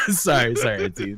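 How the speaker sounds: giggly sorry; laughing apology. Real, not acted. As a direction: apologize through your laughter. Apologizing while laughing at own joke.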